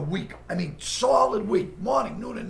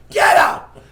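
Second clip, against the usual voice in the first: loud barked repeat, throwing him out; retold shout; 6 semitones higher, 13 dB louder, slower than usual. Studio boss ordering him out, loud shout. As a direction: yell him out of the office.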